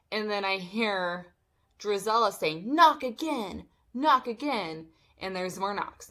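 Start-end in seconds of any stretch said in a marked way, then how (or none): 2.7-4.9 higher, louder mimicked order; mock an insistent neighbor's command; raised voice, mimicking her demand; imitating a demanding woman. Voicing Drizella outside the door.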